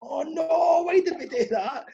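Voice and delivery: high-pitched retold lament; mock dismay. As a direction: wail it in mock regret, pitched well up. Team reacting to conceded goal.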